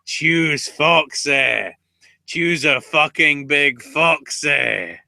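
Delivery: loud, slow Scottish brogue; Scottish accent. Pretend. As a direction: roll it out in broad Scottish. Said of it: "do a Scottish accent and say".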